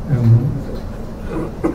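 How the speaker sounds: usual speaking voice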